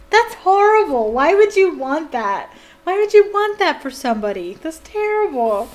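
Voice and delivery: loud, high outraged disbelief; indignant outburst. Real, not acted. Reader protesting the cruelty in the book, indignant.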